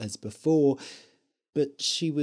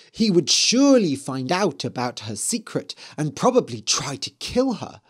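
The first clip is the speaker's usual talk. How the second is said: ordinary delivery, nothing marked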